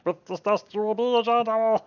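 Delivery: high, manic pixie incantation; cackling mad little sprite; maniacal pixie. Acted. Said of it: "didn't mean that to sound nearly as maniacal".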